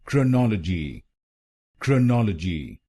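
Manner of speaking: क्रोनोलॉजी as krə-NO-lə-ji, schwa in first syllable; correct pronunciation. Shown as a demonstration. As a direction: model क्रोनोलॉजी clearly: krə-nɔl-ə-ji. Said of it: "तो बनेगा".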